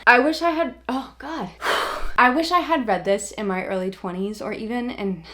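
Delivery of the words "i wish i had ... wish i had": higher, louder, on the edge of tears; fighting back tears mid-sentence; welling up. Real, not acted. Reviewer chokes up recommending a book to viewers, near tears. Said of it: "oh, I'm gonna cry again".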